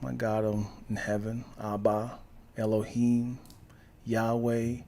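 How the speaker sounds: low, hushed mock prayer; praying aloud. Showing how people start praying, low mock prayer. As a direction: softly list holy names, lightly acted. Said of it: "when people start praying".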